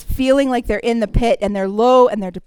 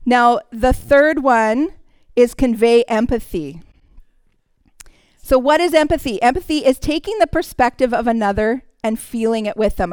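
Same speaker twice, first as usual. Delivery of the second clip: usual speaking voice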